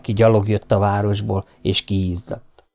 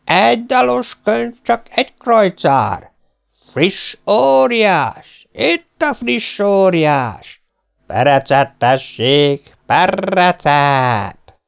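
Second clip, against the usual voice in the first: long, drawn-out hawkers' calls; slow, stretched street-seller shouting; mingled vendors' cries; 7 semitones higher, 3 dB louder, slower than usual. A narrator's reading. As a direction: cry each ware out over a market crowd. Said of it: "s egybeolvadt a kiáltozás".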